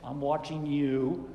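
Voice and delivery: low, slow warning; soapbox preacher. Acted. Preacher imitating Jesus pointing at people, accusing. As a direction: warn them like an accusing street preacher. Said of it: "standing on the soapbox like this".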